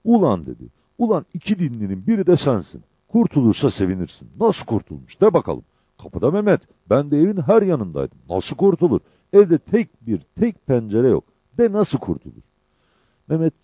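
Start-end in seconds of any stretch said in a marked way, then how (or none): 0.0-0.5 high, loud angry outburst; frantic shouting. A narrator's reading. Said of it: "bağırmaya başladı". 1.0-12.4 loud, high-pitched angry challenge; frantic shouting. A narrator's reading. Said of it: "bağırmaya başladı".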